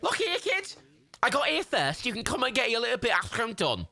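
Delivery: high, thick Scouse chancer; high-pitched cartoon Scouser; Scouse Jimmy. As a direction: argue in a squeaky Liverpool accent. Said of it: "Scouse Jimmy".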